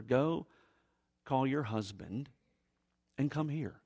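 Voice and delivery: quiet, deliberate instruction; hushed, grave. Acted. Jesus's turning-point request, after change announced.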